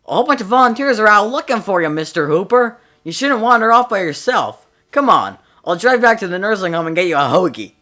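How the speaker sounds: high, loud chiding cop; cop scolding kindly. Acted. Cop to Mister Hooper, loud kindly scolding. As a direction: scold him gently but loudly, like a cop. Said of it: "he started talking to the old guy".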